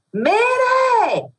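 impatient clerk yelling Mire; shouting at deaf woman. Retold or imitated. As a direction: bellow it at someone who can't hear. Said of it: "que empieza a gritarle".

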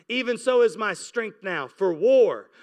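ordinary talk

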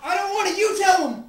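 high, loud frightened protest; panicked shout. Acted. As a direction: push it back in panic. Panicked argument under attack.

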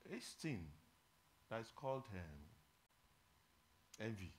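very soft, pause-laden preaching; solemn appeal. Real, not acted. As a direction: let the words sink in quietly, with long silences. Grave appeal to reflect on envy.

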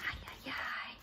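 breathless scared whisper; frightened whisper. Acted. Pigs trembling at the wolf's voice, whispered. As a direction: gasp it out in hiding.

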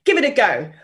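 ordinary delivery

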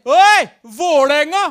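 loud, high drawn-out bellow; big hailing shout, stretched out; supporter's shout. Retold or imitated. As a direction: holler it like a rowdy football fan. Supporter hails him in taxi queue.

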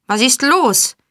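high, sharp call with a falling end; called-out question. A narrator's reading. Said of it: "rief ein Mann". (laut herbeigerufen)

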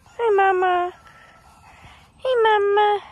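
high, loud fond hello; affectionate greeting. Real, not acted. Greeting Mama Ruthie the cow, high and fond. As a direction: greet her sweetly.

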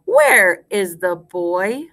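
very slow, word by word; spaced-out nursery rhyme for repeating; slow rhyme recitation. Shown as a demonstration. Teacher leads class in the rhyme, slowly word by word.

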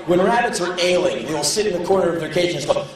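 normal talking voice

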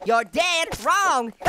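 "dead" drawn out slowly and leaned on; slow line with heavy weight on "dead"; stress on dead. Real, not acted. Player taunting the zombies, punning with stress on "dead". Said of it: "Emphasis on the dead".

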